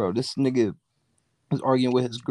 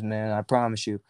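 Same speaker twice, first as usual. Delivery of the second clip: ordinary talk, nothing marked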